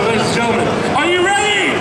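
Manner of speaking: hype announcer. Acted. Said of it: "I'm gonna ask you once more".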